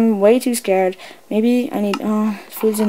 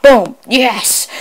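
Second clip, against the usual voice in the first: usual speaking voice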